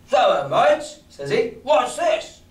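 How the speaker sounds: sailor's voice pitched well up, asking what is going on; a high, curious old sea cook; Long John voice. Acted. Long John greeting the crew on deck, high and curious.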